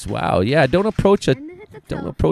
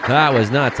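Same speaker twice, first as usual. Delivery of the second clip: ordinary talk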